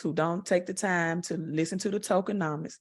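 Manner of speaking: usual speaking voice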